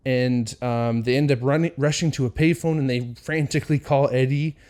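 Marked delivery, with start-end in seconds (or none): none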